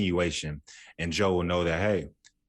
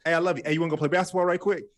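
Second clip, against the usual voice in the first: higher, bright-quick buddy voice rushing to change the subject; breezy bro follow-up; 9 semitones higher, 3 dB louder, faster than usual. Shown as a demonstration. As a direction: toss it off higher and fast, then pivot to basketball. Acting out men's follow-up line.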